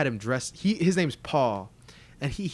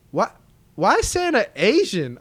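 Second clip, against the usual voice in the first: acted bafflement; 7 semitones higher, 6 dB louder than usual. Acting out baffled child seeing Santa.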